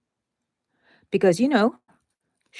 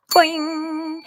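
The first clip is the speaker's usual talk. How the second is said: high bouncy spring sound; cartoon boing; 9 semitones higher than usual. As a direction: pop it like a spring toy. Playful sound effect while crafting.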